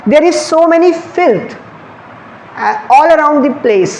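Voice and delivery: very slow, word by word, reading the question aloud; slow dictation. Shown as a demonstration. Teacher reads the exam sentence to students, very slowly. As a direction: spell it out slowly so the class can follow. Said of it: "next question जो है वो देखिए".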